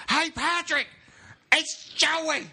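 high, strangled mock scream through a door; Joey. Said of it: "it's a redneck in the hallway screaming".

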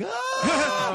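very high, drawn-out scream; mock terrified shriek, imitating the girl; imitated scream. Cornered girl under four men, high imitated scream. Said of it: "she starts screaming and freaking the fuck out".

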